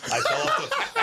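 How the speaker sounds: high laughing break on the words; laughing squeak. Real, not acted. Guest laughing as he admits falling off the wagon, voice jumping high. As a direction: crack up mid-line.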